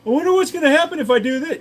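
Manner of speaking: put-on high, fast curious kid; mock twelve-year-old dumbass; high loud silly kid voice; naive kid voice. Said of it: "and I go".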